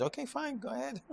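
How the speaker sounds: a high, dismissive "fine, go ahead"; shrugging God. Retold or imitated. God answers the rule-maker, high and dismissive. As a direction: let them try, with a lofty shrug. God's shrugging reply voiced.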